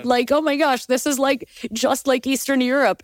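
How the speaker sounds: mock-delighted tourist gushing over it; lightly hammed-up excited friends; gushing American friends. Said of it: "they're like".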